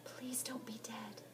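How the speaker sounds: soft, slow unvoiced whisper. Molly whispering over Heather's still body. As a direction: plead in a breathless hush. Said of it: "I whispered".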